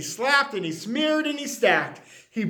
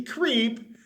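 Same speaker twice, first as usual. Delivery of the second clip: plain reading voice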